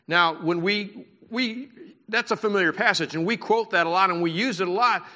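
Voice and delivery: ordinary talk, nothing marked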